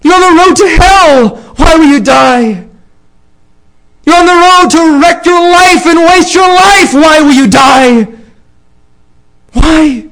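high, loud shouted pleading to the crowd; fiery preaching. Acted. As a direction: cry it out to the sinners like a revival preacher. Preaches at full force.

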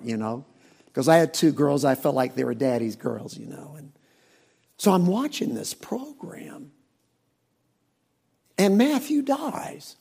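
usual speaking voice

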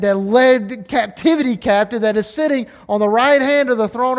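loud, high fervent preaching; preaching at full force. Acted. Preacher telling the church where he looks, fervent. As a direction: lift the church toward victory.

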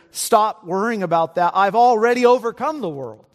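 rally the congregation against worry; fired-up preaching. Acted. Preacher rousing congregation about worry.

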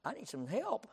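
very soft, understated plea; not-shouted plea. Shown as a demonstration. Preacher explaining why he never whispers to God, hushed plea. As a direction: drop right down, almost to a whisper. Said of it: "But I'm not whispering about it".

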